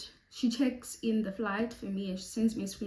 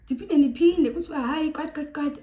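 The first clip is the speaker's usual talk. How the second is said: exasperated retelling; 6 semitones higher, 9 dB louder than usual. Retold or imitated. Retelling staff refusing her again.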